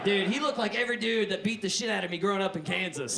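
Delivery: ordinary talk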